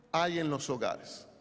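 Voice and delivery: usual speaking voice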